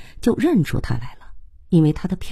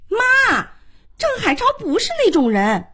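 very high daughterly objection; indignant protest. A narrator's reading. Daughter defending her boyfriend to her mother, high indignant protest. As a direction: plead his case in a high, stretched voice.

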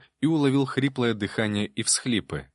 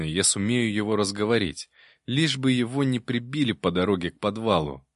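usual speaking voice

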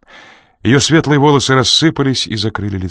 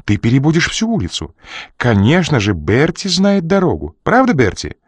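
высокий, кокетливый женский голос; игриво обратиться к Берти; 7 semitones higher than usual. A narrator's reading. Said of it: "закричала женщина". (женщина кокетливо спрашивает Берти)

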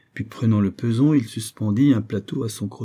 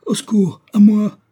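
loud, high panicked scream; desperate strangled-victim cries; cry for help; 13 semitones higher, 11 dB louder than usual. Acted. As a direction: shriek for rescue, building louder. Isaac, being strangled, calls for help.

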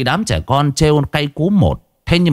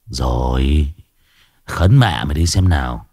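grumpy sick man; 8 semitones lower than usual. Acted.

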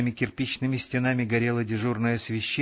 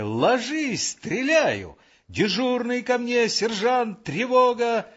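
high-pitched shouted commands; sentry yelling alarm; 12 semitones higher, slower than usual. A narrator's reading. Sentry threatening to fire and calling the sergeant, yelling. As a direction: raise the alarm at the top of your range. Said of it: "Постовой у контрольно-пропускного пункта орал".

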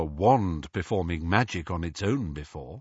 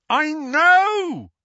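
loud, high bellow; Harry cutting off George with a loud bellow; 10 dB louder than usual. A narrator's reading. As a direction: shout it out in frustration. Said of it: "Harry bellowed".

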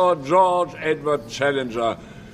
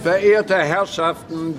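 slow, raised address to the hall; calling for order; 2 semitones higher, 3 dB louder, slower than usual. Acted. Chairman calling the audience to order.

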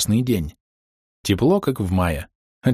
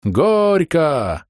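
high, drawn-out wedding chant; crowd shout; 8 semitones higher than usual. A narrator's reading. Guests urge the couple to kiss, calling out high. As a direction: call it out like guests. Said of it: "закричали со всех сторон".

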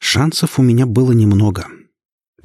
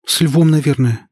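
higher-pitched, mildly frightened reply; 7 semitones higher than usual. A narrator's reading. Tamara answering her wounded husband, frightened. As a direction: answer with a touch of alarm in a raised pitch. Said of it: "испуганно сказала Тамара".

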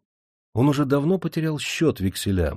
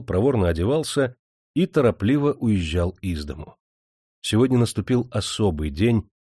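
usual speaking voice

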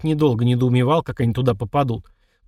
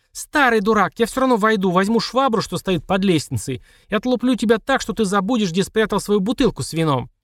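angry threat; 7 semitones higher than usual. Acted. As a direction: threaten the old fool. Marina threatens through door.

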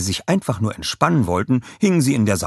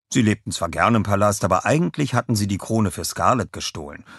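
ordinary delivery, nothing marked

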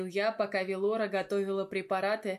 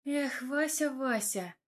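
higher-pitched, mildly exasperated sigh; 5 semitones higher, slower than usual. A narrator's reading. Velora sighs over the wounded Vasya, mildly exasperated. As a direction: sigh the name, then let it sink. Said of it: "вздохнула Велорка".

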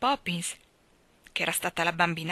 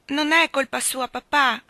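put-on loud, higher child's call from upstairs; 7 semitones higher, 7 dB louder than usual. A narrator's reading. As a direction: call down the stairs. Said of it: "gridò Jane dal piano superiore". (la figlia grida al padre dal piano di sopra, forte)

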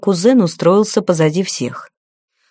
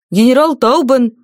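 higher-pitched military report; young guard reporting; 6 semitones higher, 3 dB louder than usual. A narrator's reading. Young guard names his commander to a superior, higher-pitched formal report. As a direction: report as if standing to attention. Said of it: "вытянулся молодой безусый стражник".

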